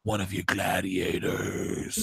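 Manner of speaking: very slow, low, hushed mock-dramatic voice; Huck. Recapper playing Huck refusing Olivia, slow low dramatic refusal. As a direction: draw out each word in a low, brooding near-whisper. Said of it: "Huck is like".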